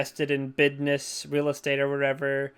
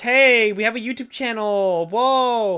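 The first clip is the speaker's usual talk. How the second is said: mock ad pitch; 8 semitones higher, 5 dB louder, much slower than usual. Mock product placement pitch.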